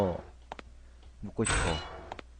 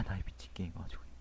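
fast, hushed fuming; whispered curse; 3 semitones lower, 9 dB quieter, faster than usual. Real, not acted. Gamer swearing at the game under the breath, fast whisper.

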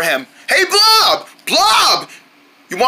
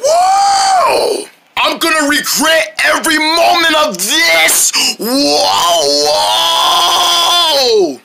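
panicked yelling; 3 semitones higher, much slower than usual. Acted. Boy thrown around on the armadillo, panicked yelling. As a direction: scream through a runaway ride. Wild out-of-control ride.

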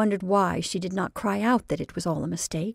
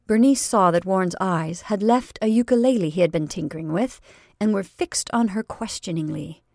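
ordinary delivery

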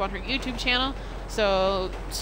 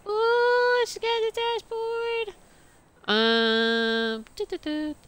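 silly high voice; 12 semitones higher, much slower than usual. Pretend. Streamer playing, silly high voice.

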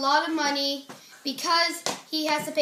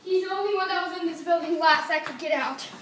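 ordinary talk, nothing marked

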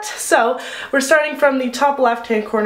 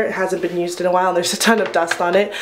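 ordinary talk

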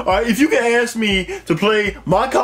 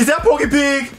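surprised; 6 semitones higher than usual. Acted. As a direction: shout it out in disbelief. Friend hears stuttering caller.

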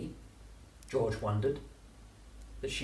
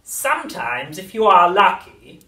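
witch voice; 9 semitones higher, 16 dB louder than usual. A narrator's reading. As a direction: cackle it like an old witch.

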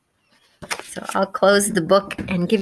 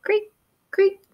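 high squeaky cricket chirps; cricket creak; slower than usual. Acted. Crickets in the grass, high creaking chirps. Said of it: "The crickets go".

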